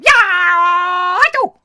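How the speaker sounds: very loud, high, drawn-out kung fu yell; Bruce Lee-style battle cry. Acted. Host jokes about watching Bruce Lee films, screamed battle cry. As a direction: let out a long screaming martial-arts cry.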